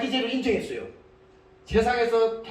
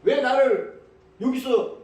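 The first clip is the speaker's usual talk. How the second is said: higher-pitched jeering challenge; acted scornful demand; mocking taunt; 4 semitones higher than usual. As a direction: taunt sarcastically, acting a bitter criminal. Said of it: "비꼬는 말입니다".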